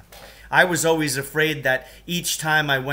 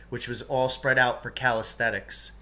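plain reading voice

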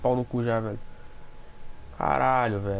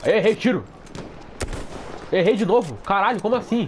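frustrated; 6 semitones higher than usual. Real, not acted. Missing shots, frustrated.

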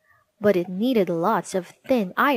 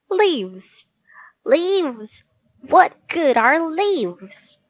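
disgusted gnome; 7 semitones higher, 4 dB louder, much slower than usual. Acted. Gnome gets only leaves.

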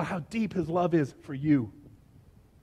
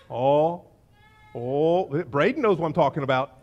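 usual speaking voice

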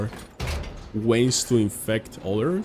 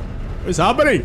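higher-pitched mock Indian accent; 8 semitones higher, 4 dB louder than usual. Streamer putting on an accent for viewers, higher Indian accent. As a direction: put on an excited Indian accent. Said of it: "Indian accent. That you do that".

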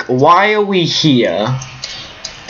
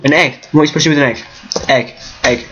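ordinary talk

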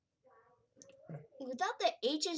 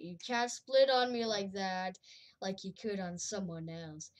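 normal talking voice

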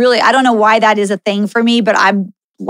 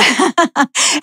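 a short, high burst of laughter; light real laugh; 5 semitones higher than usual. Real, not acted. Amused by the old lady's crash joke, she laughs lightly. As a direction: laugh at the joke, brief and bright.

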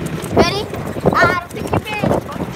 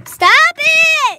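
shouting; 8 semitones higher, 5 dB louder than usual. Real, not acted. Struggle over the stop button.

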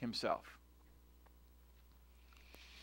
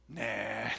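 dismissive nah; 6 semitones lower, 8 dB quieter than usual. Retold or imitated. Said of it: "and she goes".

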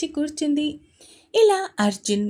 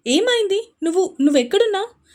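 much higher, louder, rushed alarm; panicked question; 8 semitones higher, 6 dB louder than usual. Acted. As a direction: blurt it out in panic. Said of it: "కంగారుగా ప్రశ్నిస్తూ".

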